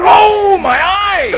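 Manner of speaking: very high, drawn-out howl; pained yell. Acted. Man hurt in the eye by furious woman, pained yell. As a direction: howl it slowly and loudly in pain. Cries out in pain.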